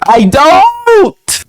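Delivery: a high, snappy correction; sharp denial. Real, not acted. Cutting off the friend's girls comment with a high, curt denial. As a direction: flick it off, way up high.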